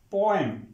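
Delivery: "पोएम" with an e sound, po-em; correct vowel. Shown as a demonstration. Teacher models the corrected word for students, correct vowel. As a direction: say "पोएम" with "em" at the end, not "eem". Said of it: "ए की आवाज आनी चाहिए".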